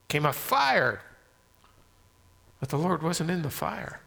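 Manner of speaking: ordinary talk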